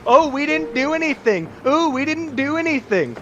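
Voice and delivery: high, whiny mock protest; mocking the elves. Dwarf-friend throws the elves' excuses back at them, mocking. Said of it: "that's what all you elves say".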